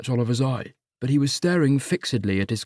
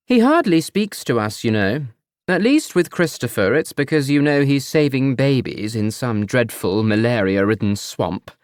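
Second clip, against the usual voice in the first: mother needling her son with faux sweetness; passive-aggressive mother; 3 dB louder than usual.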